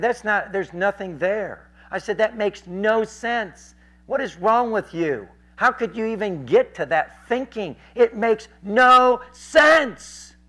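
slow, high, building to a shout; exasperated rebuke. Retold or imitated. Preacher rebukes the heckler's thinking, exasperated and building.

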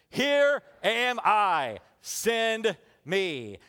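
very slow, ringing proclamation; prophet voice. Acted. Isaiah answering God's call, solemn prophet voice. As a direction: declare it like a prophet, drawing out each word. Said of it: "in your best prophet voice".

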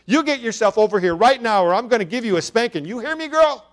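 loud, high, fast scolding threat; angry threat. A narrator's reading. Mother threatens her daughter with a spanking, loud angry scolding. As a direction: threaten the child, rising to a shout. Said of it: "her mother interrupted".

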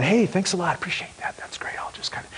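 polite thanks trailing off into a whisper; mumbled thanks dying into a whisper; fading to a whisper. Acted. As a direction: let the thanks fade away to nothing. Healed man's expected polite thanks.

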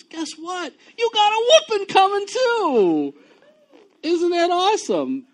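very high and loud, mock-delighted; over-the-top cheerful preacher; gleeful excitement. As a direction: sell the whooping as great news. Gleeful punchline about God's discipline.

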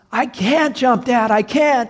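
high, loud panicked protest; acted desperate refusal; frightened son. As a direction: cry back to Dad in fear. Frightened son answers father.

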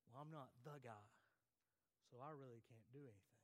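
low, soft, deflated admission; self-doubting. Acted. Preacher voicing believers who exclude themselves, self-doubting.

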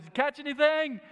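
Jesus calling out to the fishermen's boat; calling across water. Retold or imitated. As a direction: shout it out to a boat a hundred yards away. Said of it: "As loud as he can to reach to the boat".

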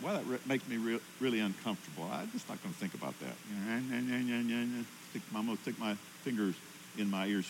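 a half-hearted, quiet excuse to yourself; mock reluctant mumble. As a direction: mumble it like you're trying not to hear. Mock inner voice dodging burden.

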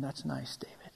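near-whispered humouring reply; unimpressed God. Acted. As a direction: murmur it low, letting him carry on. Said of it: "God must have been sitting there going".

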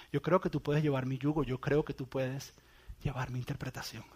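soft, gentle voice of Jesus; tender encouragement. Pretend. Jesus gently encouraging a fisherman, soft and tender. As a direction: reassure them quietly, like a promise.

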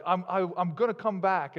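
mock movie drama. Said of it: "make it really dramatic".

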